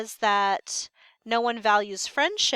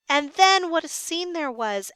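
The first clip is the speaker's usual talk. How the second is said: raised, high exclamation; excited outburst; 7 semitones higher, 6 dB louder than usual. Acted. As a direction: burst out with it. Guard recalls the chaos.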